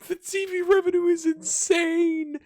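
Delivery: high, loud and slow, savoring "insane"; delighted. Real, not acted. Manager showing viewers his TV money, delighted. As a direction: gush over the number like a treat.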